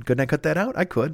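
normal talking voice

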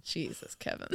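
whispered Jesus, Kevin. Real, not acted. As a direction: whisper it in disbelief. Shocked aside.

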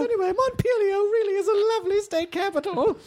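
put-on high, laughing old lady; a sweet old lady covering up. Emily as an old lady telling Kumail nothing happened, high pitched. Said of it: "turned her into an old lady".

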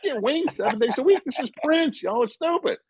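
high-pitched mock disbelief; retold incredulous scolding. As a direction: scoff at them in a high, put-on voice. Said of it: "And I'm like".

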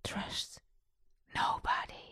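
hushed, voiceless whisper. Acted. Someone whispers the podcast's slogan before part B, hushed whisper. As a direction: whisper it very softly, word by word. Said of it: "eerst komt iemand fluisteren".

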